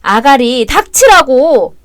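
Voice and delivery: retold furious yell. Drunk husband reenacting his threat to the mistress, roaring. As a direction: bellow it like he's about to kill her. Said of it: "아주 팍 죽일 듯이 그랬지".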